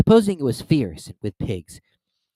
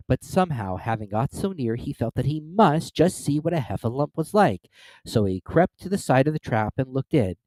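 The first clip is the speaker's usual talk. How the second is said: ordinary delivery, nothing marked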